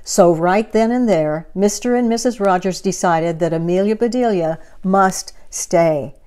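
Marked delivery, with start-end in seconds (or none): none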